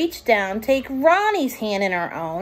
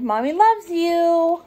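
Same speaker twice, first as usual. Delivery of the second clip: higher, tender echo; tender memory; 8 semitones higher, 3 dB louder than usual. Acted. Echo of mother's words.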